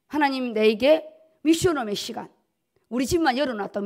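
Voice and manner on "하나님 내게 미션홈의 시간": ordinary talk, nothing marked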